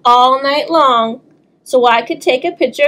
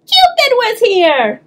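excited; 11 semitones higher, slower than usual. Acted. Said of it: "I shouted".

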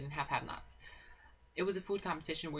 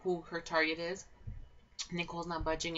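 usual speaking voice

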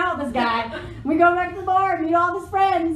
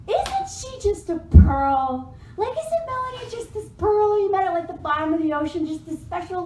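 gushing praise; 4 semitones higher than usual. Retold or imitated. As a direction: imitate a friend fawning, pitch lifted. Retelling friend gushing about the speaker.

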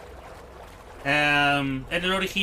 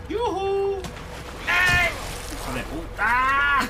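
shouting orders over battle; 10 semitones higher, much slower than usual. Real, not acted. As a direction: yell in panic mid-fight. Enemies attack, yelling.

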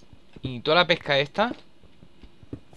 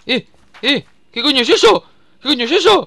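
high, loud alarmed question; startled shout; 11 semitones higher, 11 dB louder than usual. Real, not acted. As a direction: shout it in shock. Startled by sudden creature.

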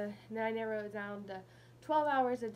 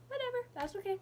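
dismissive whatever; 12 semitones higher, much faster than usual. Real, not acted. Shrugging off the retainer trouble.

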